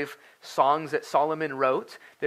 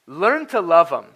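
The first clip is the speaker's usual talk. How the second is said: louder repeat; 3 semitones higher, 9 dB louder than usual. Real, not acted. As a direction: repeat "Learn to love 'em" louder, driving it home. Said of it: "Learn to love 'em".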